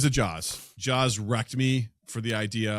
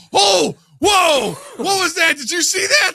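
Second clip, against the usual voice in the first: very high, shouted mock panic; hammed-up startled shouting; freaked-out bystanders; 12 dB louder, slower than usual. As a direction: yell it like you just glimpsed a monster. Acting out spooked people in VR.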